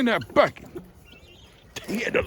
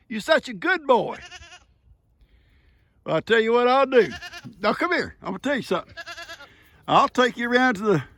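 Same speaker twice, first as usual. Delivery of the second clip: high, sing-song voice for a pet goat; pet talk; 8 semitones higher than usual. Real, not acted. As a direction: grandpa coaxing his goat, high and sweet. Talking sweetly to goat Johnny.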